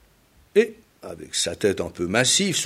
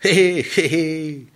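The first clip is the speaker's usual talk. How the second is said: high, breezy hé hé cackle; carefree heron laugh; 7 semitones higher, 4 dB louder than usual. Acted. Chang laughs off the tortoise's worry, carefree. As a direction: toss off a light carefree laugh. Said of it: "et il rit avec insouciance".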